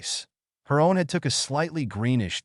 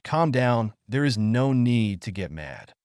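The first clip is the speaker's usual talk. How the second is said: ordinary delivery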